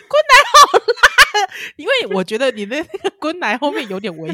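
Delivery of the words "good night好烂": laughing. Real, not acted. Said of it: "laughing verdict".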